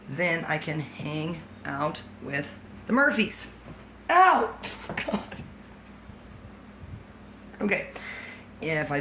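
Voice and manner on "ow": sudden yelp. Real, not acted. Sudden pain.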